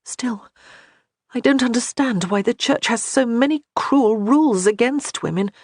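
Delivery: put-on a girl questioning the Church; young woman's voice. A narrator's reading.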